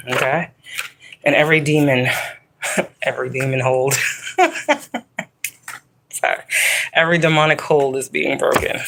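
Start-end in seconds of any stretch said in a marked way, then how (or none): 4.0-5.2 high, breaking laugh; high burst of laughter. Real, not acted. Breaking off the reading to laugh out high.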